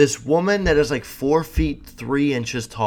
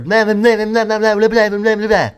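high, loud nonsense babble; mimicked yelling woman; 9 semitones higher, 7 dB louder than usual. Imitating a woman yelling gibberish behind him. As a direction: screech the blahs like a street crazy. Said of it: "I just heard".

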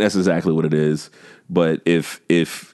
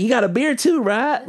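high mock girl voice. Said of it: "She's like".

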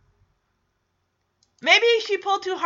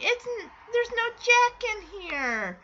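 put-on higher, softer little-kid whine; little child imitation; 6 semitones higher, 5 dB quieter than usual. [name] telling about the Jack in the Box, imitated little child. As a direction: imitate a puzzled small child.

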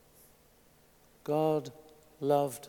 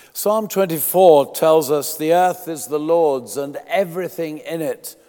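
raised, ringing pulpit reading; fervent sermon reading; scripture read aloud; 4 semitones higher, 10 dB louder than usual. Acted. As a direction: proclaim the verse.

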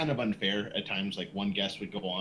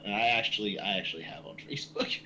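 ordinary talk, nothing marked

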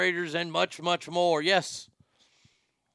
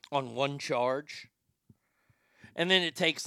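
ordinary delivery

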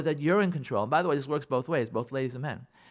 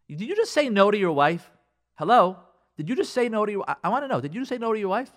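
higher, incredulous challenge; 5 semitones higher, 4 dB louder than usual. Acted. Coach challenging a husband who refused his wife, incredulous. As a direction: demand it in rising disbelief. Speaker acts shock at the refusal.

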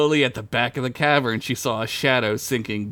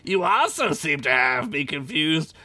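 shrieking spider lady; 13 semitones higher, slower than usual. Acted.

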